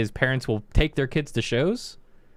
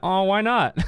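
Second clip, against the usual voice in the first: higher, faux-hurt little question; mock innocent; 9 semitones higher, 4 dB louder than usual. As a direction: act innocently puzzled to tease him. Teasing the rude kid.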